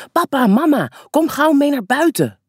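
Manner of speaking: put-on high, loud and eager child's call. A narrator's reading. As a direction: call them urgently outside, voice up high. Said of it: "roept Kolletje". (opgewonden roepen, hoog en luid)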